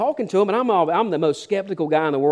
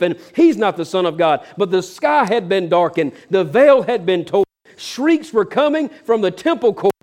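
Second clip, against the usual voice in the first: emphatic pulpit delivery, punched key words; preaching; 3 semitones higher, 4 dB louder than usual. Acted. Preacher proclaiming the centurion's confession to a congregation, forceful preaching. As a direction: preach it with force and drawn-out climactic lines.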